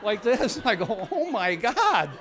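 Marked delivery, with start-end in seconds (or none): 1.1-2.1 slow, high laughing "Oh, my God"; stunned by the owls; drawn-out giggling wonder; amazed disbelief. Retold or imitated. Amazed owls shook heads.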